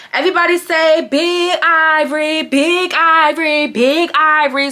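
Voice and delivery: rowdy MC leading a name chant; drunk hype on the mic. Retold or imitated. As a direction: whip the crowd up chanting your own name. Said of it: "she gets on the mic, like".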